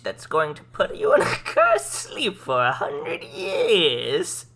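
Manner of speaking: cackling witch threatening a curse. Acted. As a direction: play an evil witch. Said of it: "my witch voice".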